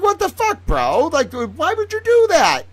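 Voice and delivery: very high, loud, slow outcry; outraged yell. Retold or imitated. The world yelling at Koala, very high and loud. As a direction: squeal it out in high disbelief. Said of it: "We're like".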